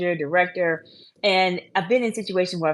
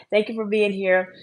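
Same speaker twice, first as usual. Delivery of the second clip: ordinary talk, nothing marked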